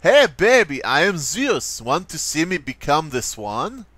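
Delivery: put-on sleazy pickup artist with an Eastern European accent; mock Eastern European lothario. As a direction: hit on someone in a cheesy Eastern European accent. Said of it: "acted out by all Eastern European pickup artists".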